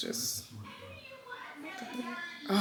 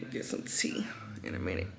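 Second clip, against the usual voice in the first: whispered aside; 11 semitones lower, 8 dB quieter than usual. Real, not acted. Aside about needing tea.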